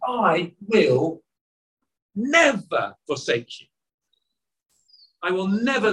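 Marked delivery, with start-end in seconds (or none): none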